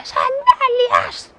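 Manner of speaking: put-on high, drawn-out little girl's whine; toddler demanding. Little girl whining at her mother for sandals. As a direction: stretch it out like a stubborn toddler.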